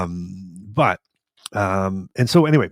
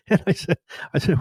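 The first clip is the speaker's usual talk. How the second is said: laughing; 9 semitones higher, 4 dB louder than usual. Real, not acted. Laughing while recalling doctor laughing.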